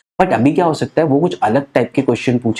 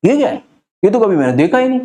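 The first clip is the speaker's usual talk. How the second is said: mock surprise; 6 semitones higher, faster than usual. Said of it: "surprise नहीं हो".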